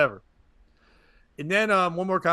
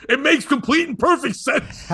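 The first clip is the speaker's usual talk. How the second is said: out of character; 9 semitones higher, 4 dB louder than usual. Retold or imitated. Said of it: "totally out of Dan's character".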